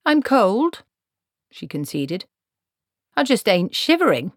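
put-on a no-nonsense old witch taking charge; stern old woman. Granny speaking.

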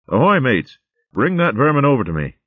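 loud, high hail across camp; calling out. Acted. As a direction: call the crew over. Chieftain calls across camp.

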